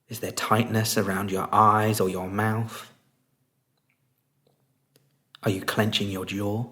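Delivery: soft, unhurried meditation voice with long pauses; guided meditation. Real, not acted. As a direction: guide them gently into stillness, leaving space between phrases.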